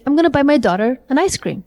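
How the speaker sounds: whole phrase linked, "gonna" for "going to"; connected speech. Shown as a demonstration. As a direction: run "an ice cream" together, stressing "daughter" and "ice". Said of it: "what I'm really saying is".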